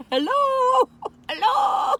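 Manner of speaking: high, loud sing-song hello; calling out. Real, not acted. Vlogger checking her unseen viewers are still there, high calling out. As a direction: call out to viewers you can't see.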